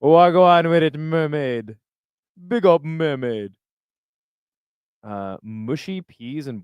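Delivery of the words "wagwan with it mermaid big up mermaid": mock Jamaican, pitched higher and louder; playful Jamaican patois shout-out, stretched slow at the end; hammed-up Jamaican accent greeting. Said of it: "Do a Jamaican accent".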